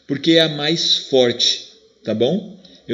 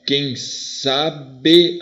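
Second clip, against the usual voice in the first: "Quem sabe" split into slow, separate syllables. Shown as a demonstration. Teacher scanning the next verse, "quem sa-be" syllable by syllable. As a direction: space it out as "quem sa-be". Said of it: "vamos ver quantas sílabas".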